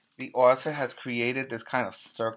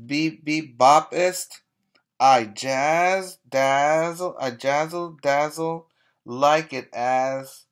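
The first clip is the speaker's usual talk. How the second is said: louder, punchy rap-like read; rhythmic poem reading; 6 dB louder, slower than usual. Acted. Reading the hip hop dog's boast to kids, punchy. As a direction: bop through the rhymes. Reads rhyming dog poem.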